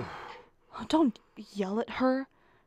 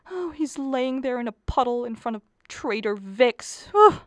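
normal talking voice

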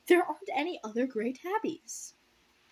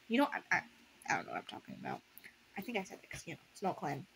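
plain reading voice

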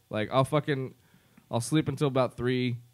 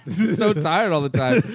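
repeated through a laugh, louder; 5 semitones higher, 7 dB louder than usual. Shown as a demonstration. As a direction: laugh it out and stress so. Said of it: "laughs, repeats louder".

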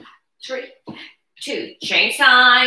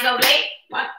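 ordinary talk

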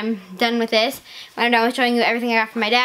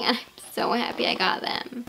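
whispered, much softer; happy whisper; 3 semitones lower, 8 dB quieter than usual. Real, not acted. Kid gushing over new shoes, soft whisper. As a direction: breathe it out in delight.

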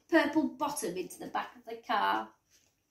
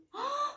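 sharp, high intake of breath; shocked gasp; 5 dB quieter than usual. A narrator's reading. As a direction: gasp in sudden amazement. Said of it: "gasped Mum".

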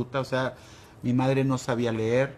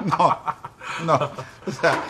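laughing; 5 semitones higher, 5 dB louder than usual. Real, not acted. Laughs at own joke.

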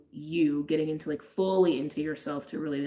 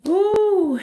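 surprised ooh; 12 dB louder than usual. Real, not acted. Card reveal surprise.

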